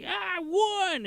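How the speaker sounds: high, gleeful victory yell; excited cheer. Retold or imitated. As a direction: kid celebrating in the winner's circle. Speed cheering after win.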